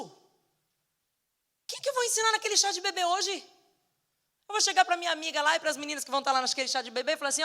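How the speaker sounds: loud, high-pitched exasperated rant; acted-out angry outburst, high and loud; irate complaint to God. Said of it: "eu voltei irada e chorando".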